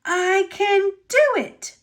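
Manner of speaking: high, loud strained wheeze; wheezed. Acted. Engine straining to pull his friend. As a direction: push the words out under effort. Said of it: "Stanley wheezed".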